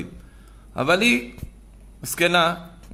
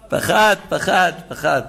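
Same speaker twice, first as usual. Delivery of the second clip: mock fear; 3 semitones higher, 4 dB louder, slower than usual. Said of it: "הוא שקשק".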